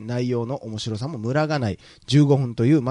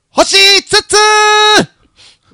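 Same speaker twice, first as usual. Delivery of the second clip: very loud, high-pitched shout with long drawn-out vowels; うるさい絶叫; 15 dB louder, much slower than usual. Shown as a demonstration. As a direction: belt out the star rating, stretching it right out. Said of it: "うるさー。". (うるさいほどの甲高い大声で長く伸ばして)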